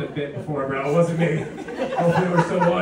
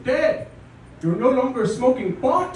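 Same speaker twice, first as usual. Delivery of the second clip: deli friend; 7 semitones higher, slower than usual. Retold or imitated. Deli friend voiced, different timbre.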